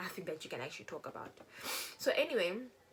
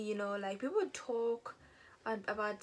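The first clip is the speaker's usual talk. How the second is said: ordinary delivery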